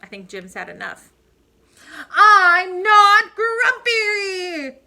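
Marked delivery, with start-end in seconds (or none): 2.1-4.8 much higher, very slow, bellowed outburst; explode, drag every word out loud; furious shout. Acted. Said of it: "shouted Jim as he beat his chest".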